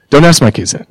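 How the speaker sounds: ordinary talk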